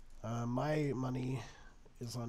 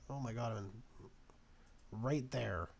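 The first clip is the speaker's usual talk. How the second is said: ordinary talk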